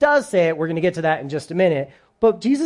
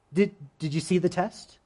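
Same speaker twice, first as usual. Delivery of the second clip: ordinary talk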